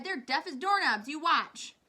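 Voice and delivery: loud, high cocky boast; crying out. A narrator's reading. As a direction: cry it out scornfully, high and loud. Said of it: "he cried".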